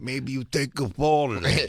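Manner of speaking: put-on impression voice with little shift in pitch; lightly voiced impression offering football advice; Chris Italian. Impressionist suggesting football plays, put-on character voice. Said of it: "It's also my Chris Italian impression".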